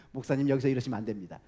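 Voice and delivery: calming church member. Retold or imitated. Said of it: "뭐 이러는 거예요".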